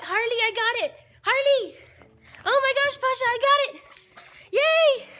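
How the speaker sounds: high, loud joyful calling to the dogs; excited high shout. Real, not acted. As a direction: shout the news to the pets. Telling dogs package came.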